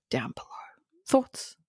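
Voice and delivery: soft unvoiced whisper. Real, not acted. Passing to co-host in a whisper. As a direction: hand over in a hush. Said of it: "whispered handover".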